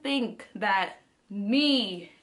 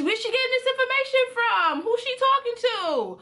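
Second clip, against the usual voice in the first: high, fast, disbelieving questions; incredulous; 12 semitones higher, 3 dB louder than usual. Real, not acted. Wondering aloud who told grandma, incredulous. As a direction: pitch way up in baffled disbelief. Baffled how grandma knew.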